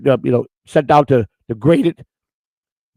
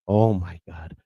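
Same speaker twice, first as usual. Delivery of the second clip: soft, low imitation of an embarrassed wife; quietly dismayed, as the wife; understated mock mortification; mortified wife; 6 semitones lower, 6 dB quieter, much slower than usual. Wife mortified by his outfit, retold.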